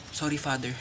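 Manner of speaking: soft low Sorry; hushed church apology. Acted. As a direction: murmur sorry at mass. (mahinang paumanhin sa simbahan)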